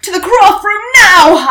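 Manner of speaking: loud, high alarmed shout; detective shouting to the whole palace to follow; exclaimed. Acted. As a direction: yell it out, sending everyone running. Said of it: "I exclaimed".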